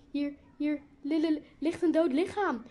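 high, loud, stuttering alarm; frightened stammer. A narrator's reading. Isabel showing friends a dead body, stammering in fright. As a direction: stumble on the word, then burst it out.

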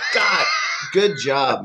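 laughing praise. Real, not acted. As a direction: laugh as you congratulate.

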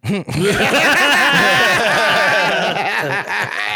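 loud laugh. Real, not acted. Laughing at Jake's answer.

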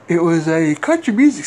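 yawning while talking. Real, not acted. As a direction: talk through a yawn. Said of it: "Sorry for yawning again".

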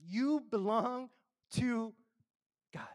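a preacher's rising call, slow and weighty on the communion words; preaching at full force. Acted. Pastor urging the church they belong to God, preaching full force. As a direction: raise the pitch and proclaim it to the congregation.

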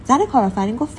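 usual speaking voice

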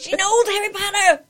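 high, loud taunting boast; gloating mock yell; imagined trash talk. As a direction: crow it through laughter. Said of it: "I said all that other stuff on the inside".

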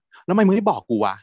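hurt, accusing demand for an answer; angry demand. Retold or imitated. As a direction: throw the question at him in a jealous huff. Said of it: "โกรธมาก".

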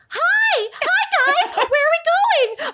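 very high, perky squeal; young actress's show voice. Retold or imitated. Actress mimicking herself at twenty-two on a kids show, high perky voice. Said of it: "my voice on that show".

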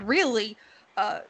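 ordinary talk, nothing marked

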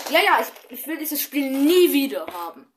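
mock rant. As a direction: play the friend fed up and yelling. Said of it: "so über dieses Spiel ausgerastet".

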